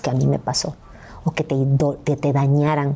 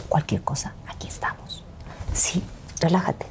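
hushed whisper; 7 dB quieter than usual. Retold or imitated. [name] whispering backstage before she runs away. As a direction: breathe it out as a secret.

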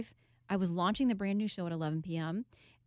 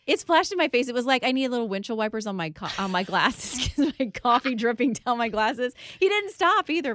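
excited retelling; 4 semitones higher, 9 dB louder than usual. Real, not acted. Laughing retelling of coffee spill.

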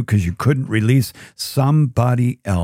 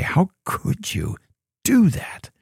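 distressed rebuke; 7 semitones higher than usual. Retold or imitated. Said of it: "I'd be distressed too. I say".

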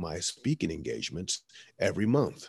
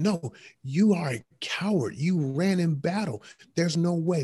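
high, louder furious accusation; upset scolding; 9 semitones higher, 4 dB louder than usual. Retold or imitated. Alexander scolding the boy who ran from battle, loud and angry. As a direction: berate the coward. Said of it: "Alexander the Great got so upset".

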